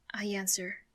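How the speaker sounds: usual speaking voice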